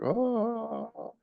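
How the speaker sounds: high breathy gasp of wonder; awed oh. Retold or imitated. Walking up to the field, a high awed oh. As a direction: struck by the sight of the field.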